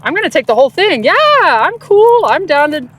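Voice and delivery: mock casual, too-cool voice; high, drawn-out nonchalance; playing it cool. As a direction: act unbothered, breezy. Retelling his cool reply to her.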